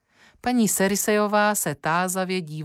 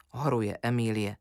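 usual speaking voice

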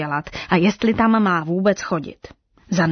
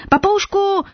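high, loud cry across the crowd; high loud call; 14 semitones higher, 4 dB louder than usual. A narrator's reading. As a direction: shout to get the parrot's attention. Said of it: "vykřikla žirafa".

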